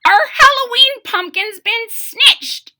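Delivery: put-on very high, loud old-woman shriek; outraged ancient crone; cry of an ancient woman. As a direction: cry out in a seven hundred-year-old voice. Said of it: "she cried in a seven hundred-year-old voice".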